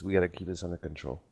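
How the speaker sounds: ordinary talk, nothing marked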